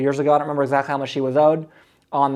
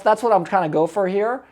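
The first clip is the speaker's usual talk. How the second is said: normal talking voice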